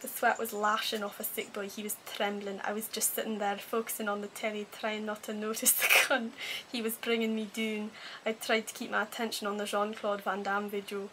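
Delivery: put-on a self-conscious, amateur Scottish accent reading a novel; attempted Scottish accent. Promised Simon a reading of Trainspotting, in a shaky-attempt Scottish accent. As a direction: have a go at reading it in a Scottish accent, not quite pulling it off. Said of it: "I would attempt to do a Scottish accent".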